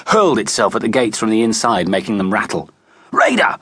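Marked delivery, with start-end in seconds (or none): 3.1-3.6 high, urgent yell for the dog; commanding shout of a name; roared. A narrator's reading. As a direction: call the dog back sharply, pitched high. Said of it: "roared Mickey".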